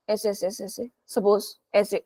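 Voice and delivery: quiet demonstration. Shown as a demonstration. Teacher acting out a gesture for students, softly. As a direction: drop the volume and pitch while demonstrating.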